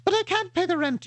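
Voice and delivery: high squeaky distressed heroine; damsel in distress. Acted. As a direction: melodrama maiden in falsetto. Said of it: "the old gag".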